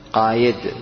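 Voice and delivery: قايد with ya, no hamza; wrong ya form. Shown as a demonstration. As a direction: show the everyday mistake قايد. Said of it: "ولا تقل".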